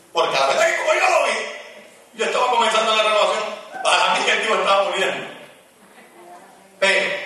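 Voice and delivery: high, loud revival preaching; fiery preaching. Acted. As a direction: preach it out at full force. Preaching at full force.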